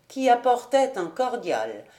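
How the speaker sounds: ordinary delivery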